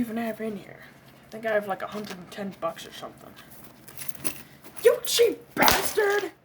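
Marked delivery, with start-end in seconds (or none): 4.8-6.3 "You cheap bastard" slow and higher; angry insult. Acted. Friend scolding a cheap buddy, higher and slow. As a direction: spit the insult out slowly.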